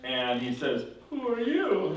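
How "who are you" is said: high-pitched, baffled demand; startled father. Retold or imitated. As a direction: act out a father's alarmed question. Father confronts the second son.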